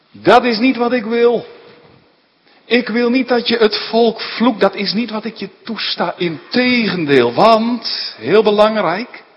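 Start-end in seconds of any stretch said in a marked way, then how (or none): 0.1-6.1 stern divine refusal. Acted. God forbids Biliam to curse.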